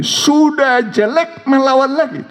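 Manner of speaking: high, mocking voice of a scornful husband; husband insulting his wife, in a high sneering mock voice. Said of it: "dia bilang begini".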